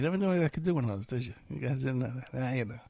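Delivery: usual speaking voice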